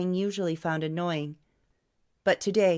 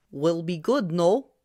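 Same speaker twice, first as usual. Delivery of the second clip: a lightly voiced old barber with a thick Slavic accent; broken English in a Slavic accent, rolling along; 4 dB louder than usual. A narrator's reading. Old barber asking the maestro about tomorrow, in a thick Slavic accent. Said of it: "in a thick Slavic accent".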